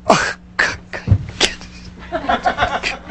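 whispered, fed-up mutter under the breath; mock groan of a parent the kid got to; exasperated groan. Shown as a demonstration. Parent walking away from the kid, mock exasperated whisper. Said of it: "you don't go".

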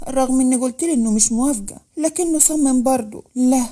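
ordinary delivery, nothing marked

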